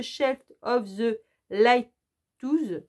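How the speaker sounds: halting English with a French accent; clumsy French-accented attempt at English; bad French-accented English. Real, not acted. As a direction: sound out the English title uncertainly in a French accent. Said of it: "Désolée pour mon anglais pourri".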